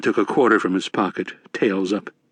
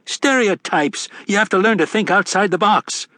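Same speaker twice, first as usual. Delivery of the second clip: higher, brash Irish barfly; rowdy leprechaun; 10 semitones higher than usual. A narrator's reading. Mad Sweeney voiced in narration.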